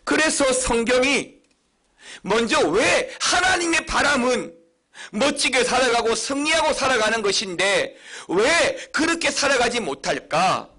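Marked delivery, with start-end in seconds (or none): none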